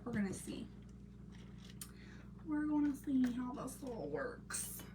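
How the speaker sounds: unvoiced whisper to herself; whispered self-talk while crafting. Real, not acted. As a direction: breathe it while thinking.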